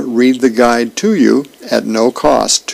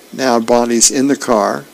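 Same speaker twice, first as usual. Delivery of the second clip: normal talking voice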